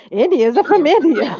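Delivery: mocking repeat of "Indians are from India"; mocking quote. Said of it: "people saying that shit".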